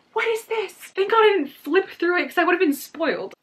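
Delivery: high, loud shocked disbelief; loud, high-pitched dismay; appalled outburst. Real, not acted. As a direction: cry out at the awful sight. Appalled by the illustration.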